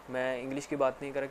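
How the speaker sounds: ordinary talk, nothing marked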